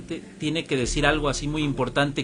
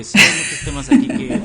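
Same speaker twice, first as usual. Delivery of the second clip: louder, laughing through the words; 8 dB louder than usual. Real, not acted. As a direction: laugh as you say it. Laughing while wrapping up.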